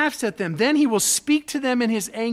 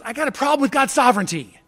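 usual speaking voice

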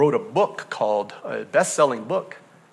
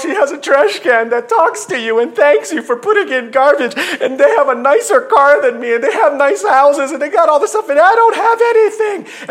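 high, loud mocking whine with a laugh in it; mock a jealous whiner at the top of the voice; whiny complainer; 11 semitones higher, 8 dB louder than usual. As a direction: pastor lampooning covetous churchgoers, whining. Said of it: "We're whining and pining".

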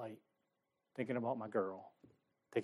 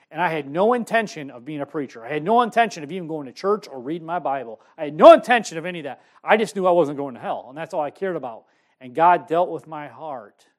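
louder, building preacher's testimony; preaching; 3 semitones higher, 10 dB louder than usual. Acted. Preacher telling the congregation how God dealt with his heart. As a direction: drive it home from the pulpit.

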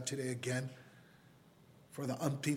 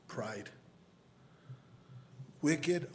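normal talking voice

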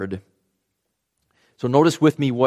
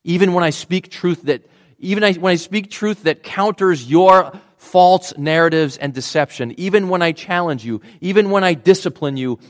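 insistent, raised preaching in first person; God; 6 semitones higher, 6 dB louder than usual. Acted. God assuring his people even while challenging them, insistent. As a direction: press each clause higher and louder. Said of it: "that God wired into the world to say".

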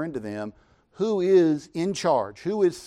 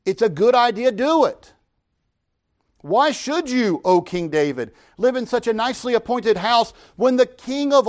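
raised, louder preaching voice, pitched higher; fervent preaching; 8 semitones higher, 7 dB louder than usual. Acted. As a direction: preach it with rising zeal. Preacher retells Nathan's answer.

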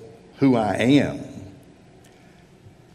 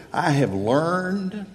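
"learned" drawn out slowly on a raised pitch; stress on learned; 6 semitones higher, slower than usual. Shown as a demonstration. Preacher quoting Paul to the congregation, stressing "learned" slowly. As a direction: lean long and high on the word "learned". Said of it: "emphasize that word learned".

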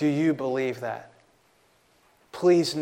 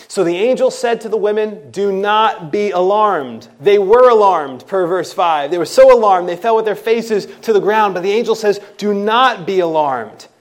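higher, louder impassioned preaching; full-force preaching; 7 semitones higher, 13 dB louder than usual. Acted. Preacher pressing the angel's message on the congregation, loud and high. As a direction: press the point with fire.

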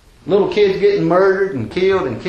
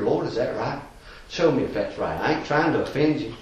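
meek quiet prayer; 3 semitones lower, 6 dB quieter than usual. Retold or imitated. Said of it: "I'd crawl back up here".

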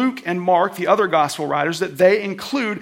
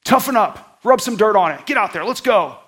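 loud, punchy coach's pep talk; rally a hurt player like a tough coach; raised-voice sideline urging; 3 semitones higher, 5 dB louder than usual. Acted. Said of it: "Is he like a coach?".

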